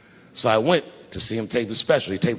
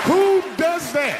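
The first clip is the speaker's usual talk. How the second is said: very high, slow, stretched disbelief; incredulous outrage; much slower than usual. Real, not acted. Comic outraged that his wife opened the box. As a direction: drag it out like you can't believe it.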